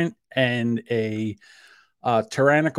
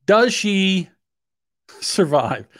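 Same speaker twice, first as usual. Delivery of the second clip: ordinary talk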